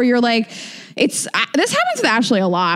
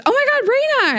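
excited fan; much faster than usual. Retold or imitated. Excited fan voiced in story.

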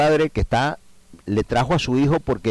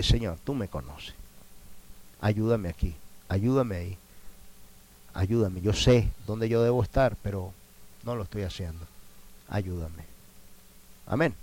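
1 prayer; 2 semitones lower, 5 dB quieter than usual. Acted. Modeled honest prayer.

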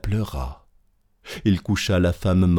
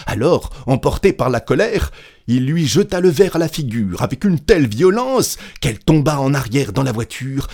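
higher, louder, heated narration; récit emporté; 5 semitones higher, 4 dB louder than usual. A narrator's reading. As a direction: tell it with mounting violence. Narrating a violent fit of anger. (narration véhémente, voix montée)

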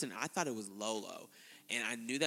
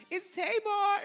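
much higher and louder, sing-song hello; cute Tamar greeting; 9 dB louder, slower than usual. Retold or imitated. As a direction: chirp your name for the camera. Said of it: "so cute when she was like".

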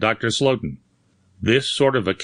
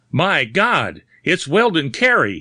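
a horrified shout, louder and higher; 6 semitones higher, 3 dB louder than usual. A narrator's reading. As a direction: cry it out in sudden horror at the corpse. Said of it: "he shouted".

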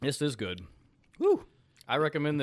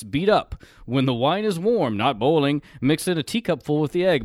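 recipe reading; 3 semitones higher, 8 dB louder than usual. Acted. Reads old recipe aloud.

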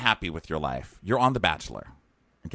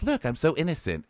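mock-innocent higher voice; sweet innocent act; 7 semitones higher than usual. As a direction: lightly put on sweetness, pitched up. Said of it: "she acts really sweet and innocent".

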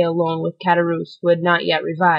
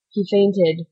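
plain reading voice

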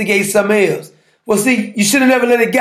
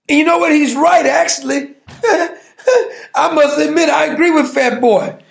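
laughing; 6 semitones higher than usual. Real, not acted. Laughing agreement with rival.

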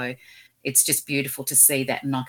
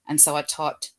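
ordinary talk, nothing marked

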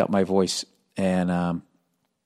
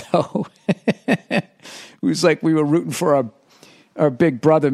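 laughing; 5 semitones higher, 4 dB louder than usual. Real, not acted. Laughing while recalling rooting.

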